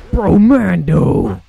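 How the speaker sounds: loud, drawn-out mock announcer shout; game announcer imitation. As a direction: boom it out slowly like the game's announcer. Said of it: "I just love that guy's voice".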